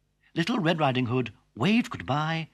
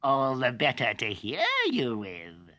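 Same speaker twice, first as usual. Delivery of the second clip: sly wolf. Acted. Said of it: "replied the wolf".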